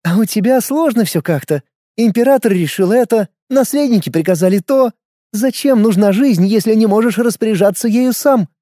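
a hint of a sly older woman; cheeky, knowing woman's voice; lightly voiced Jones. A narrator's reading. (лёгкий голос Джонс)

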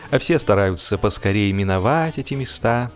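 plain reading voice

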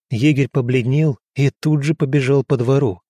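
ordinary delivery

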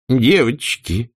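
slow, drawn-out plea; Aunt Pitty pleading with the girls. A narrator's reading. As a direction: stretch it, begging them stop. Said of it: "взмолилась мисс Питтипэт".

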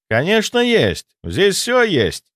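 высоко, с нажимом и растяжкой в конце; заверь твёрдо, не торопясь к концу; уверенное заверение. A narrator's reading. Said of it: "заверил Святослав". (уверенно заверяя)

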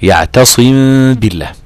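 slow "يعتصم بالله" with a nasal hum on the meem, lips not fully closed; recited with ghunna. Shown as a demonstration. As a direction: say "يعتصم بالله" with the meem hummed through the nose. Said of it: "الغنة تخرج بمقدار حركتين".

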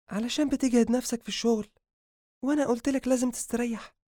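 put-on high-pitched young woman's reproach; concerned scolding. Habiba scolds Adham for overworking, concerned. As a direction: nag him out of care.

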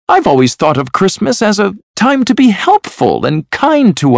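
high, heartfelt speech; earnest nephew. A narrator's reading. Nephew defending Christmas to his uncle, earnest and high.

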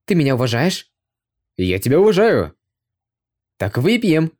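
boozy drinking-party shouts; drunken bawling. A narrator's reading. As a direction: bawl it like tipsy soldiers. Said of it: "Горлопанили они".

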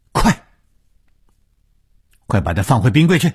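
loud, high, fast urgent order; stern command. Acted. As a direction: order him sharply and urgently. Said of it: "严厉地接着说". (严厉急促地大声命令)

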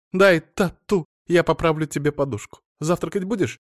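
wife fussing over her husband, in a high chatter. Acted. Wife character voice.

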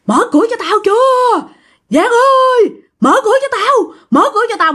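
very high, strained acted yelling; demanding shout. Ghost banging on the door and demanding entry, high angry yell. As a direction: demand to be let in, pitch far up.

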